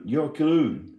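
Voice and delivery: ordinary talk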